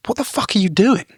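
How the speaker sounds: retold outburst of disbelief, pitched right up; incredulous confrontation. Confronting the fight fan who woke everyone, incredulous. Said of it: "I was incredulous, beside myself".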